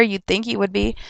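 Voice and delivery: normal talking voice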